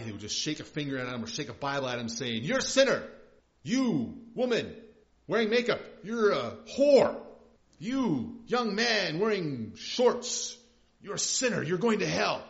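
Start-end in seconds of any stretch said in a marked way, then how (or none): 2.4-12.4 loud, high hellfire street-preacher shout; condemning campus preacher. Acted. Campus preacher condemning students as they walk by, loud imitated rant. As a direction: imitate a preacher hurling accusations at passersby. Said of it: "some preachers would get out and stand on the campus".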